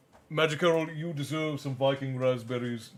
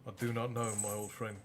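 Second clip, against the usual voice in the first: much softer, hoarse and weary; 3 semitones lower, 9 dB quieter than usual. Acted. Pug answers his old friend, soft and hoarse. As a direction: let it fall away to a hoarse hush. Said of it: "Softly, hoarsely, Pug spoke".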